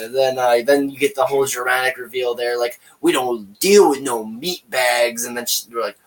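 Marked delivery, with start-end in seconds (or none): 3.0-5.2 drawn-out tough-guy line, lightly voiced; slow mock gangster voice. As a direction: friends retelling a movie, slow mock gangster. Retelling puppet gangster's line.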